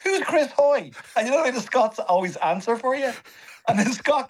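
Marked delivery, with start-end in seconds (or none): 0.0-0.9 high, baffled "Who's Chris Hoy?"; baffled question. Retold or imitated. Comedian asks the crowd who Chris Hoy is, baffled and high. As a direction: play it totally clueless about the star. Clueless comic asking who.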